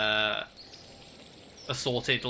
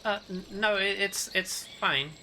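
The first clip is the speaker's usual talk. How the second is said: higher-pitched, stammering reassurance; hesitant character voice; 7 semitones higher than usual. Acted. Game master's character brushing off an apology, higher and hesitant.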